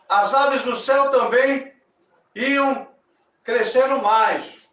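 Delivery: loud, ceremonious storytelling voice; story narration. A narrator's reading. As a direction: proclaim the myth to the audience. (narração solene e alta)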